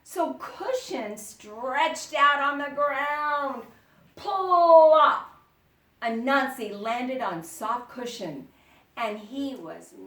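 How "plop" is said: loud, high plop; loud comic sound effect. A narrator's reading. Anansi lands on cushion.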